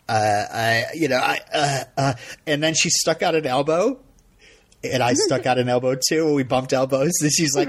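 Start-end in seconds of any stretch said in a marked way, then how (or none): none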